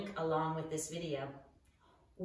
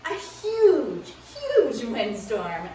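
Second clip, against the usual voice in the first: dramatic emphasis; 3 semitones higher, 9 dB louder, slower than usual. Acted. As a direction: make the storm sound enormous. Dramatic storm in story.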